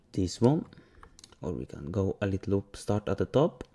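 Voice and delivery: normal talking voice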